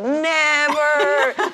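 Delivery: retold refusal through a laugh; laughing never.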